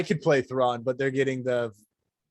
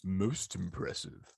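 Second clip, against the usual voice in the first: low, soft, slow Vader line; Darth Vader; 6 semitones lower, 10 dB quieter than usual. Pretend. Vader quote continued.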